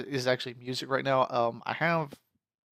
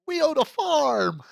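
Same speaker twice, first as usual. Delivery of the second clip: much higher, louder and slower cheer; excited; 7 dB louder, slower than usual. Real, not acted. Gamer to viewers on inheriting the farm, much higher loud cheer.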